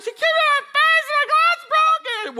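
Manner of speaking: very high, loud, fast mock wail; hysterical tattling kid. Brother tells mother about the kick, hysterical tattling. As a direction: blurt it out as an outraged child.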